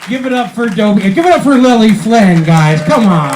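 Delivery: loud, high emcee hype; real loud crowd call; rousing host shout. Real, not acted. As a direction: whip the crowd into applause.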